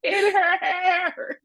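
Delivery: shrill squeal of disbelief; in her hair. Real, not acted. Telling Alicia the gross detail, squealing. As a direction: squeal it out, half laughing. Horrified laughing punchline.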